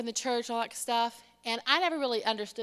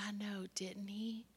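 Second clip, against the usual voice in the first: slow whisper, imitating an awed child; hushed awe; 11 dB quieter than usual. Little girl admiring God's painting, awed whisper. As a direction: whisper it slowly in wonder.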